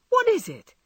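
high, loud sudden wonder; surprised cry. Acted. Jo forgets her woes in wonder, surprised cry. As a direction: burst out in curiosity. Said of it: "cried Jo".

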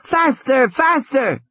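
put-on high-pitched excited child's cry; yelling. A narrator's reading. Lex holds onto the raft's gunwale, yelling with excitement. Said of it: "Lex yelled".

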